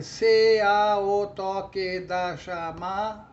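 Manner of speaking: slow, even chant with "C" and "A" split; counting normal syllables. Shown as a demonstration. Teacher counting all nine common syllables, evenly chanted. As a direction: count every syllable through the final "da" of "chamada". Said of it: "contar as sílabas normais".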